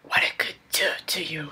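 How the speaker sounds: breathy unvoiced whisper. Hero whispering to Anna, seductive whisper. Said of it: "he whispers".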